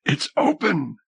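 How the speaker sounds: high, exultant cry; Helene's high exultant shout; exulting. A narrator's reading. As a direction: burst out in triumph. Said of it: "Helene exulted".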